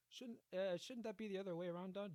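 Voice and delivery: mock-nervous query to the Don; timid objection. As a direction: question the boss meekly, play-acted. Acts timid questioning of the Don.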